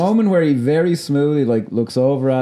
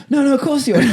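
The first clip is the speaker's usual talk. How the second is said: squeaky-high imitation, on the edge of laughter; high-pitched mock backtracking; 14 semitones higher, faster than usual. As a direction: jump into a high, comic quote voice. Acting out character's hasty backtracking.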